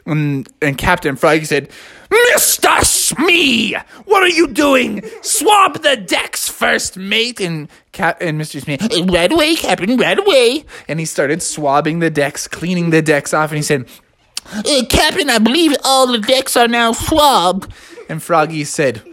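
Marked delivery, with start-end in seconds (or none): 2.1-7.4 slow, high shouted order; scolding captain. A narrator's reading. Captain barks orders at Smee. 9.0-10.6 higher, servile sailor voice; eager first mate Smee. A narrator's reading. 14.5-17.7 high-pitched reporting sailor; eager first mate Smee. A narrator's reading.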